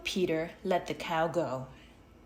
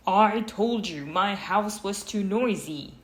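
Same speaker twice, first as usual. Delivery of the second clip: angry complaint; 4 semitones higher, 6 dB louder than usual. A narrator's reading. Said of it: "Now Peter was angry".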